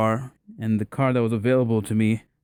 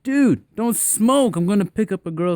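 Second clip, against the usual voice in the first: exasperated pleading; 8 semitones higher, 4 dB louder than usual. Retold or imitated. Begging his friend not to smoke before date, exasperated. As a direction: plead with a pal, mock-exasperated. Scolds friend smoking in the car.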